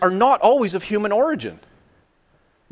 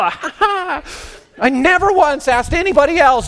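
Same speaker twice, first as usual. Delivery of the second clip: laughing; 9 semitones higher, 4 dB louder, slower than usual. Real, not acted. Laughing at own selfishness.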